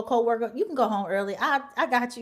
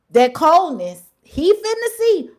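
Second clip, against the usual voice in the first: higher, louder, slow menace; ominous emphasis; 6 semitones higher, 8 dB louder, slower than usual. Real, not acted. Warning the fired man what's coming, loud and slow. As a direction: lean into the threat. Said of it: "repeated, stronger".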